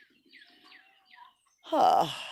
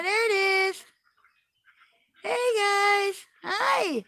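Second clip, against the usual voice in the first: higher, gentle cooing voice; 5 semitones higher, slower than usual. Real, not acted. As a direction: greet tiny kittens sweetly. Cooing at newborn kittens.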